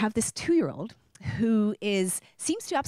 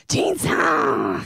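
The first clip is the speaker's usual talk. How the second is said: louder, lower delighted call of her name; imitated shout; 5 semitones lower, 6 dB louder than usual. Colleague answering her praise, loud delighted call. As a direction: break the tension with a joyful shout. Colleague bursting out, breaking ice.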